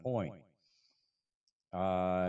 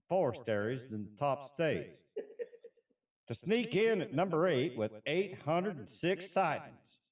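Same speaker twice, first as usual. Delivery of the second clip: put-on Texas accent; 2 semitones higher than usual. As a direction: read it like a folksy Texas cowboy, Texas accent throughout. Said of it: "for that accent".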